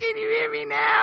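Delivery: faint, distant voice over a noisy line; bad connection, hissing background; quiet voice loud hiss. Real, not acted. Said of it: "You're really quiet now, buddy".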